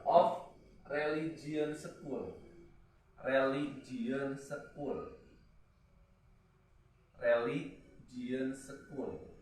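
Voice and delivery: very slow "religion school" drawn out word by word; slow teacher dictation. Shown as a demonstration. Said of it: "Nah, kamu saja of".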